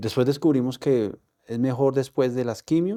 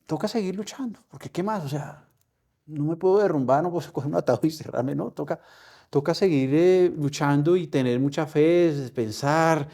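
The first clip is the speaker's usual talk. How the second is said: determined resolve; 6 semitones higher, 3 dB louder than usual. Retold or imitated. Resolving to keep fighting.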